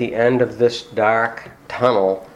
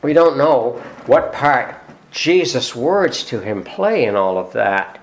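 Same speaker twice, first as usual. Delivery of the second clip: usual speaking voice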